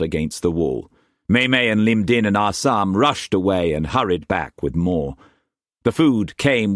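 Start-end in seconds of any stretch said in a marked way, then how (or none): none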